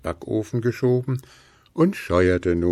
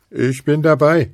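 loud, high burst of joy; a little dwarf cheering loudly; 8 semitones higher, 7 dB louder than usual. A narrator's reading. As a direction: shout it out with glee. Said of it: "jubelte der Zwerg".

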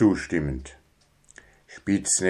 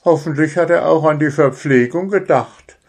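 much higher, eager critter voice; eager hedgehog; 7 semitones higher, 3 dB louder than usual. Acted. Hedgehog hopes for nuts at the event, eager. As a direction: look forward to the snacks.

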